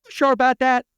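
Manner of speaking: loud, high-pitched comic catchphrase; put-on voice, pitched right up and projected; Tim Robinson impression. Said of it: "Tim Robinson".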